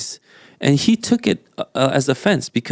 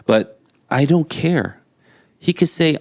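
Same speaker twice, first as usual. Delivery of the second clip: ordinary talk, nothing marked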